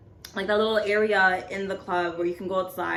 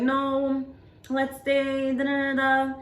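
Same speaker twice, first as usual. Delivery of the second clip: higher, drawn-out tipsy pleading; imitated drunk friend; 5 semitones higher, slower than usual. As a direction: mimic a drunk friend begging to stay. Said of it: "she was so drunk".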